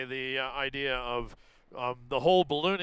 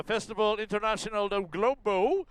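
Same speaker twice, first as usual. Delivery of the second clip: Spanish accent; 4 semitones higher than usual. Real, not acted. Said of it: "don't get too into the accent there".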